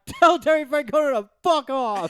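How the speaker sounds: high, loud mock fan shout; mimicked heckler yell. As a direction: holler it like a heckler in the stands. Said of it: "And then the one guy".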